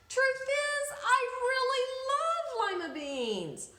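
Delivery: high, loud, blurting it out; eager confession. Acted. Camellia admitting to the old woman, high and loud. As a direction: burst out with the truth. Confession right after shout.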